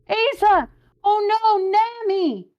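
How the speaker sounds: very high, loud panicked shout; alarmed cry. Acted. Crewmate crying out for friends, high and loud. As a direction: scream their names in alarm.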